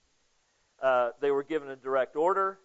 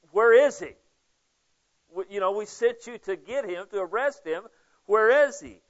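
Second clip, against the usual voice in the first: loud, higher demand on each "Where is he"; 5 semitones higher, 6 dB louder than usual. Acted. Pharisees confronting the officers who came back empty-handed, loud and demanding. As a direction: bark the question at the officers.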